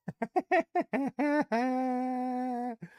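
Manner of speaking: long high giggle trailing out; real drawn-out cackle; high laughter. Real, not acted. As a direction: burst out laughing at the joke. Laughs after reading chat.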